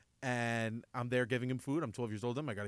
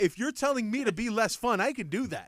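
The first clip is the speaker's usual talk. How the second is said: firm dad; 8 semitones higher, 5 dB louder than usual. Retold or imitated. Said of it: "I'm like".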